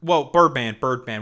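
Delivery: real loud call to halt; loud interruption. Real, not acted. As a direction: burst in loudly with repeated whoas. Cutting off an over-long explanation.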